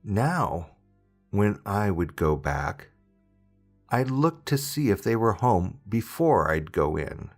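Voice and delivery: usual speaking voice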